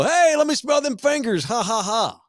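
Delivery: high-pitched teasing dad impression; hammed-up dad with a mock laugh; joking dad. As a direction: tease like a dad cracking himself up. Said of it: "My dad thought it'd be funny and go".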